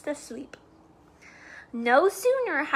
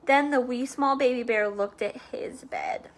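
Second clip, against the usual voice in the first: usual speaking voice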